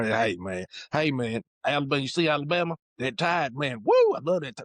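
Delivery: fast, loose imitation of rural Southern country talk, with a high whoop on woo; imitating country talk. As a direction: mimic the country guys from work, rural Southern accent. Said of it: "that's literally how they talk".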